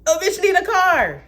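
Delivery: rising to a high loud plea; hammed-up desperate begging; mock begging. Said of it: "I'm just begging, like".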